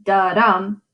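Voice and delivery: unstressed then stressed syllable; iamb rhythm. Shown as a demonstration. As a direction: beat it out like a pulse. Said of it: "two beats in each iamb".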